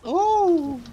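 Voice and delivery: high, loud spooky ghost "ooh"; ghost wail. Pretend. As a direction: moan it out like a cartoon ghost. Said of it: "It was my ghost impression".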